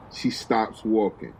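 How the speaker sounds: ordinary delivery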